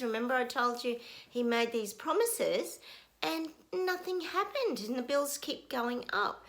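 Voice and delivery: ordinary talk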